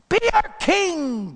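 high, drawn-out crowd cry; acted loud plea. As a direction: plead loudly like an adoring crowd. Crowd begs Gideon to rule.

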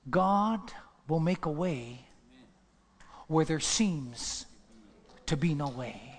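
loud, slow, rising sermon climax; preaching at full force. Acted. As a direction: drive it home to the congregation.

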